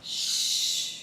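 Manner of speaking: breathy hush; shushing. Pretend. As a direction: hush like keeping a secret. Hushing about married Chewie.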